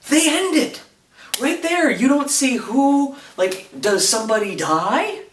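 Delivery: high-pitched, worked-up fan outrage; beside yourself over a cliffhanger; exasperated outburst. Real, not acted. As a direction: rant at a high pitch, nearly yelling. Outraged rant about the cliffhanger ending.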